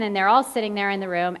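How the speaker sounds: ordinary talk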